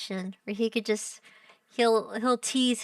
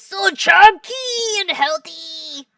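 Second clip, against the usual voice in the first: loud, high, drawn-out coo; demonstrated gushing squeal; 6 semitones higher, 9 dB louder, slower than usual. Shown as a demonstration. Streamer showing how texts should be read, squealing. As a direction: belt it out slowly in baby talk. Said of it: "you gotta read it like this".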